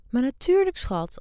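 high, loud, eager mother saying yes to her son; mother-in-law answering her son, high and loud; doting mother. Acted. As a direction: gush it like a mother who approves of everything he does. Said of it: "antwoordde zijn moeder".

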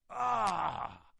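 loud, high cry of "ah"; angry disgusted cry. Retold or imitated. Satan cries out on seeing Jesus, angry and disgusted. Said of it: "utterly angry and disgusted".

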